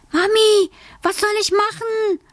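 put-on high, quick girlish voice; bright child's voice, lifted high; little girl Alice. A narrator's reading. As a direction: play a pleased little girl. Alice calls her mother.

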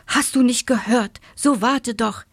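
mildly exasperated parental call. A narrator's reading. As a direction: urge the child to wait. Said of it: "rief der Marienkäfer Papa noch einmal".

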